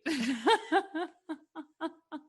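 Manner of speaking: loud, high real laugh; high burst of laughter. Real, not acted. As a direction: break into a bright laugh. Laughs after mock reply.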